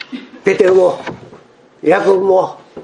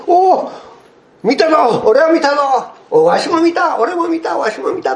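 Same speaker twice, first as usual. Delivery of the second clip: high, jubilant overlapping claims; excited disciples; 11 semitones higher than usual. Retold or imitated. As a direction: play several disciples bragging in turn. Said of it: "口々に言った".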